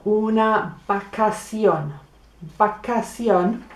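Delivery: Vacación said bakasion with a b; slow teaching demo, v as b; slow Spanish b sound. Shown as a demonstration. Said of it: "practicar saying some B words".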